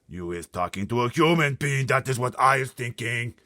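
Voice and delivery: loud high booming giant. Acted. Blood Bottler booming at the BFG. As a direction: boom the insult.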